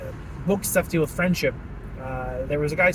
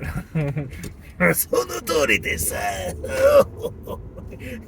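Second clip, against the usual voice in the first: mock-evil Japanese exclamation with a cackle; evil Japanese villain cry. Driver playing harmless villain, evil Japanese shout and laugh. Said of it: "It's like evil Japanese".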